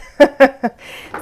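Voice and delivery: bursting into a loud laugh. Real, not acted. Laughs at her own fumbling.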